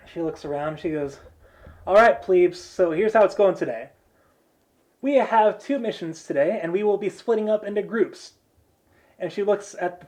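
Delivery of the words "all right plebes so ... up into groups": higher, louder briefing voice; rally the troops with cheeky authority; brash mission leader. Acted. Blonde girl briefing the plebes on missions, brash leader.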